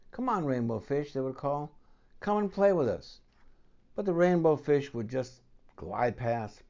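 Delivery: lively storybook read-aloud; reading to children. Acted. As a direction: tell it to a child at bedtime. Reads picture book aloud.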